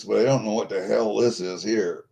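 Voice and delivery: ordinary delivery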